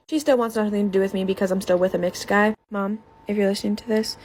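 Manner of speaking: ordinary talk, nothing marked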